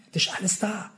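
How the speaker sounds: an awed secret whisper. Acted. Preacher drops to hushed aside.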